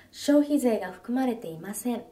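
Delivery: ordinary delivery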